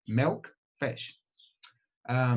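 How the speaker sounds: ordinary delivery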